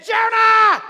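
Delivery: loud high shout of his name; calling out. Acted. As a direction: bark the name across the deck. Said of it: "They said".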